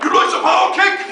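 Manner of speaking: high, mock fighter's call; misheard game move. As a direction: mimic a video game attack cry in a high voice. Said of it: "It sounds like".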